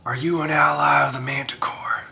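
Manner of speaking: whispered, probing; hushed wary question. Acted. Wolfkin cautiously asks the wisp about the Manticore, hushed.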